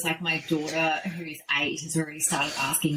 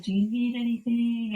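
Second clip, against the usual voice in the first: caring daughter; 6 semitones higher than usual. Retold or imitated. Daughter's caring question voiced.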